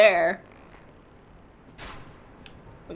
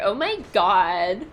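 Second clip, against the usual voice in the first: ordinary delivery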